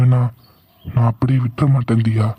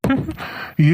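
high amused laugh; 10 semitones higher, 4 dB quieter than usual. Acted. Amused at her leaving.

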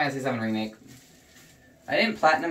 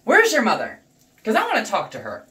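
louder and higher, like a scolding parent; scolding demand; 6 semitones higher, 4 dB louder than usual. Real, not acted. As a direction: demand it sharply. Scolding racists.

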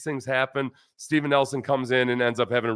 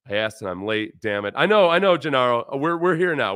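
ordinary talk, nothing marked

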